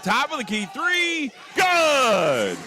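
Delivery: climbing high into a loud drawn-out shout; real play-by-play burst; excited shot call. Real, not acted. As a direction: build to a big yell as it drops. Live call of made three.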